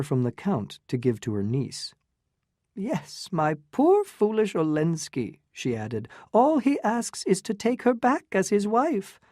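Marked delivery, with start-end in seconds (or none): 2.8-5.4 put-on high, affected elderly lady; fluttery old aunt. Medora tells Newland about the Count, high and affected. 6.3-9.2 put-on high, affected elderly lady; fluttery old aunt. Medora tells Newland about the Count, high and affected.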